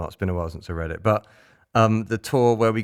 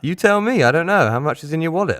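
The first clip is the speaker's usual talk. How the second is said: higher and louder, indignant; indignant retort; 8 semitones higher, 5 dB louder than usual. Retold or imitated. Tim snaps back at the border guard, indignant and louder. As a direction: fire the questions back at the guard.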